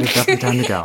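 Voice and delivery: nose held shut while talking; pinching nose. Real, not acted. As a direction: talk with someone pinching your nose. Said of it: "now she's holding my nose".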